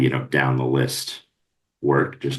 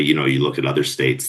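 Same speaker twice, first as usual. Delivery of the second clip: ordinary delivery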